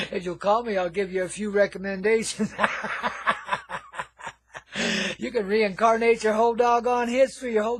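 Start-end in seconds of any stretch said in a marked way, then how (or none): 2.6-4.3 a wheezing, nearly silent stoner giggle; airless giggle. Acted. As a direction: laugh through the teeth at your own joke. Stoner host laughs at own joke.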